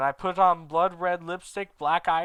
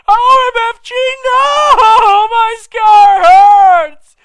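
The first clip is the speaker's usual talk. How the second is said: very high, much louder wail, sung out and dragged very slowly; screaming; 20 dB louder, much slower than usual. Acted. Vampire screaming over his hurting scar. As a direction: wail in agony. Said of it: "he started to scream".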